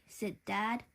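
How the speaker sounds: ordinary delivery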